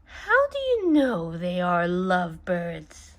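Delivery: ordinary delivery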